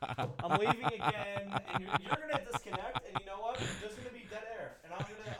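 soft, retreating mock resignation; hushed, playful farewell; mock walkout. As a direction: back away quietly, as if giving up. Mock walkout after co-host's joke.

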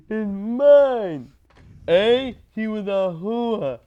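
slow Jersey gangster drawl; mobster voice. Pretend. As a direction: play it like a Sopranos capo. Mobster quotes continue.

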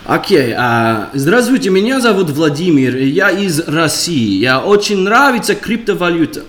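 Russian character speaking his own language; Russian accent. Acted. Greeting the hosts as Vladimir from Russia, Russian voice. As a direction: introduce yourself as Vladimir in Russian. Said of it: "I have to s-, do Russian".

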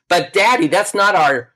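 little sister. Retold or imitated. Little sister blurting out to her dad, loud child's protest. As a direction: pipe up as a little kid, cut off mid-sentence.

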